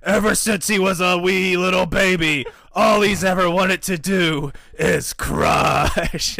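loud, high announcer bellow; Vince. Acted. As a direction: boom it out, stretching the final word. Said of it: "He goes".

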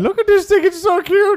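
put-on high squealing baby-talk coo; cooing over puppy. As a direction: gush over a cute puppy. GM coos over cute puppy.

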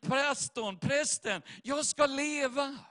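retold shout. Preacher retells soldier shouting in his ear, mock yell. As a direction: imitate an urgent shout without full volume. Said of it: "Skriker i mitt vänstra öra".

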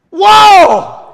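loud, high, falling whoa; awestruck shout. Acted. Preacher relives his late-night revelation, shouting in amazement. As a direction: bellow it in astonishment. Reenacts amazement once more.

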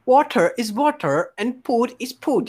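put-on Filipino-accented English. Repeating the mystery line for guessers, in a Filipino accent.

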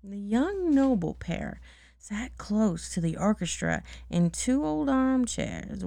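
plain reading voice

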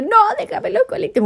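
high mock-weepy plea; imitated tearful refusal. Imitating herself begging the driver, tearful. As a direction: plead in a high, whiny voice. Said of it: "lloraba, lloraba".

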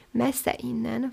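plain reading voice

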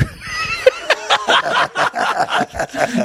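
howling laugh. Real, not acted. Big laugh at own story.

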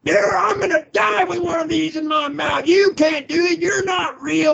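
high, shouted defiance; yelling at God. Retold or imitated. Man re-enacts shouting back at God, high and furious. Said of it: "Boy, I just lost it".

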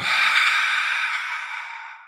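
long breathy sigh out through the mouth; demonstration sigh. Shown as a demonstration. Guide showing the listener how to sigh, audible exhale. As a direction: let the breath fall out in a sigh. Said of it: "Let it all go".

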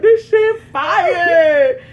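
high, loud, hugely stretched last word; drawn-out ecstatic shout; mock raving. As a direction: imitate a friend blown away by food. Acts out friend's sudden food rave.

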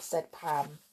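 plain reading voice

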